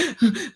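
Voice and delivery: short sobbing gasps, acted out; retold tearful gasping; imitated crying gasps. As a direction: mimic catching your breath while crying. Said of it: "I'm crying now".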